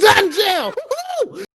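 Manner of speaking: high, loud mock celebration; excited cheer. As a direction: cheer like a proud parent. Celebrating son's success.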